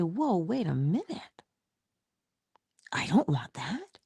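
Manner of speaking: soft, whispered catch of oneself; hushed realization. Retold or imitated. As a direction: breathe it as a sudden insight. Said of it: "and you say".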